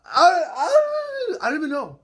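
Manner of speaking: screamed very high and loud, slow, voice cracking; crying shout of joy. Real, not acted. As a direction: howl it out half sobbing with joy. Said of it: "marking out like bananas".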